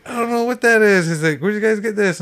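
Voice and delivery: hammed-up confused old-timer; baffled old man. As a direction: play the old man who can't make sense of it.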